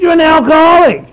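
loud, high, flat insistence; blunt old-timer. Retold or imitated. Old-timer insisting to the newcomer, loud and blunt. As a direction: hammer it home to his face. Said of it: "He said".

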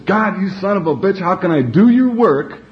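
high-pitched mock yell at God, little added volume; half-strength imitated scream of complaint; retold scream. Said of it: "screamed in the top of my voice".

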